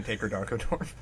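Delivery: ordinary talk, nothing marked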